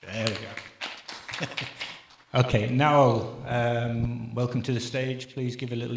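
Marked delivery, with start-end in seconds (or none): none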